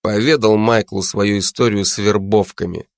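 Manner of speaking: ordinary delivery, nothing marked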